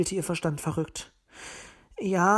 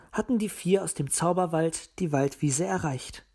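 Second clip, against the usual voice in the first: plain reading voice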